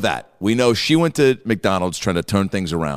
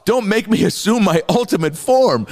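Hulk voice; 11 semitones higher, 3 dB louder than usual. Retold or imitated. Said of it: "Isn't that what Hulk says?".

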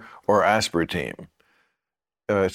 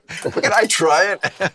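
infomercial; 7 semitones higher, slower than usual. Acted. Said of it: "we're doing an infomercial".

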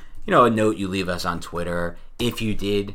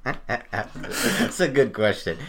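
laughing; 3 semitones higher, much slower than usual. Real, not acted. Laughing at the shocker joke.

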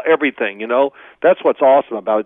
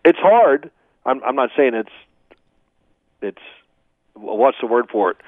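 ordinary delivery

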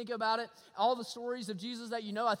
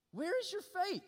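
higher, more urgent repeat of the question; pressing rebuke; 8 semitones higher than usual. Acted. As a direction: lift the voice and press the point home. Jesus repeats his rebuke.

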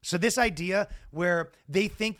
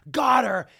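gloating; 8 semitones higher, 7 dB louder than usual. Retold or imitated. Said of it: "they go".

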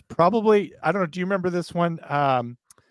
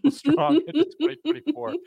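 high, laughing through the words; breaking into laughter; 10 semitones higher than usual. Real, not acted. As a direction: try to finish the sign-off while cracking up. Host cracks up during ad read.

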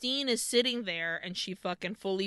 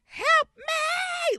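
high, drawn-out mock scream; hammed-up screaming imitation; theatrical scream; 14 semitones higher, 3 dB louder, much slower than usual. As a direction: wail it in full theatrics, stretched out. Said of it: "She's screaming".

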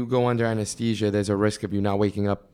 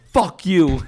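loud, higher-pitched mock defiance; retold yelling; 6 semitones higher, 5 dB louder than usual. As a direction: yell the curse back as a re-enactment. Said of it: "He's yelling again".

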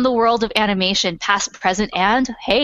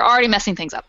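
normal talking voice

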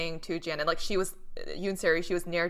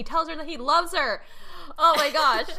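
high, loud, building to a squeal; gushing; 10 semitones higher, 8 dB louder than usual. Real, not acted. Fan tells friends he confessed his love, gushing. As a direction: swoon out loud, laughter breaking in at the end.

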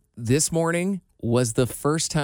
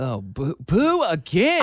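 mock booing; 3 dB louder, much slower than usual. Mock jeering at the question.

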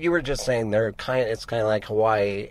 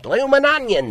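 high, loud hammed-up Australian accent; a cartoonish Australian accent, pitched up; 5 dB louder than usual. Shown as a demonstration. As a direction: show off your Australian accent with a stock phrase. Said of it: "Let's hear it".

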